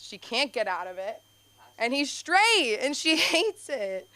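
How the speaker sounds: normal talking voice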